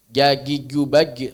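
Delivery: Gagi gubagi with hard g sounds, slow; Egyptian g for jim. Shown as a demonstration. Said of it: "Jim orang-orang awam".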